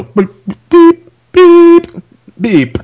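high, sustained beep noise; imitated censor bleep. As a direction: mimic a TV censor tone. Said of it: "I think they bleeped out".

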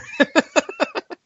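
high laugh. Real, not acted. Laughs at own story.

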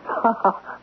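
a short airless chuckle of disbelief; breathless amused laugh. Acted. Man laughing off the woman's fear, soft breathy chuckle.

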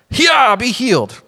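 high karate cry, drawn out; hammed-up kung-fu healer; mock faith-healing kick. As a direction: play at healing with a martial-arts yell. Acting out karate-kick foot healing.

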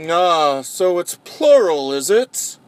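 ordinary delivery

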